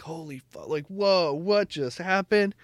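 high, drawn-out amazement; acted-out astonished whoa; re-enacted stunned shock. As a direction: reel from a huge twist, pitch climbing. Re-enacts shock at plot twist.

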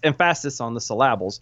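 emphasis as em-FASS-is, syllables as sil-LAB-ulz; joking misplaced stress. Shown as a demonstration. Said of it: "wherever you want to".

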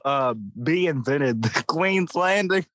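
high-pitched mock-earnest insistence, breaking into laughter; dripping with sarcasm, barely holding back a laugh; sarcastic. Real, not acted. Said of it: "sense the sarcasm in your voice".

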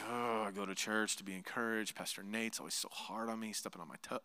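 low, soft churchgoer's moan; mock grumbling. Preacher mimicking a congregant's complaint about him, mock grumble. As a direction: grumble quietly like a put-upon listener.

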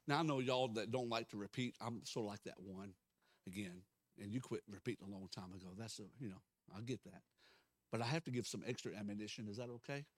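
ordinary talk

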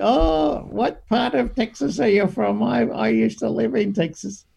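lightly voiced old lady. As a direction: quiz him like a curious grandma. Voicing the lady asking.

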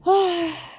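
high, falling sigh; audible winding-down sigh. Real, not acted. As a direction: let out a sigh before wrapping up. Winding up before leaving.

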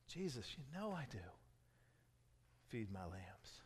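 soft, near-whispered dialogue; hushed intimate retelling. Acted. Preacher retelling Jesus asking Peter, soft and hushed. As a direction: draw them in with a hush.

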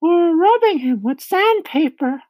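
very high, loud little voice; ladybug. A narrator's reading. Ladybug adding a cure for the centipede, high voice. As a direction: pipe up in a high ladybug falsetto. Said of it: "the ladybug said".

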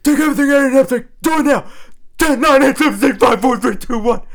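fast, loud countdown yelled high; drill sergeant shout. Retold or imitated. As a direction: bark the countdown at recruits. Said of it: "The drill sergeants will be like".

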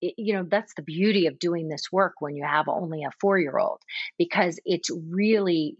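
ordinary talk

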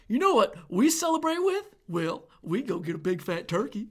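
lightly voiced proud American, a touch of smugness; mock America voice. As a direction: playfully show off a bland turkey feast. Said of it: "America's like".

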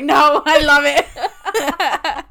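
high, loud, slow and laughing; gushing through laughter. Pretend. Bestie imagines gushing over every wedding dress, laughing. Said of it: "I'm like".

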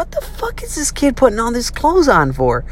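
high, exasperated mom. Retold or imitated. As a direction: voice the puzzled mother. Mom's voice quoted, high and far.

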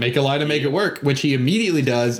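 ordinary delivery

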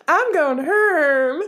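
high, slow, crying "I'm going Herm"; mock accent. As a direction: whine it like a sob. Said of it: "Sounds like you're saying home with an accent".

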